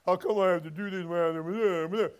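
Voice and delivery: a lightly voiced whiny gripe; mock whining. Preacher mocking a griping cross-bearer, light whine. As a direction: poke fun at the complainer with a whine. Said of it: "You see him saying".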